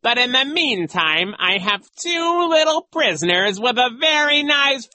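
very high, loud gloating; taunting villain call. A narrator's reading. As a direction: crow your threat across the lawn. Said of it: "he called to them".

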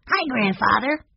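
put-on high, rising child's voice; high-pitched boyish hello; boy's greeting. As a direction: greet Grandpa as a little boy. Young boy greets his grandfather.

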